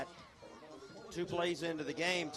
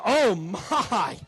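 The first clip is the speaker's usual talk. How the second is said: surprised; 6 semitones higher, 8 dB louder, much slower than usual. Real, not acted. Big hit on kickoff return.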